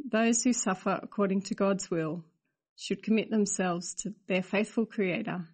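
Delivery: normal talking voice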